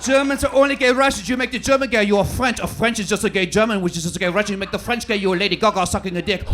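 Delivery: loud, hammed-up mock German; exaggerated German accent sliding toward French. Shown as a demonstration. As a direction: camp comic European accent, from German to French. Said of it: "all of a sudden you are German".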